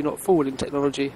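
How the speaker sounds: ordinary talk, nothing marked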